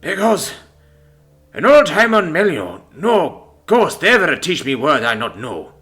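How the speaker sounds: put-on high, slow accented scorn; indignant, heavily accented complaint; scornful. A narrator's reading. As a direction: scorn it in a thick Greek accent. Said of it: "He'd been scorned of the word".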